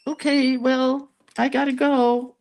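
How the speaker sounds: high, loud nervous brush-off; chirpy excuse to flee the conversation; awkward exit. Retold or imitated. Escaping after the exile story.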